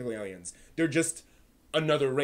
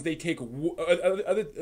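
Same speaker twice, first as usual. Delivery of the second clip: ordinary talk, nothing marked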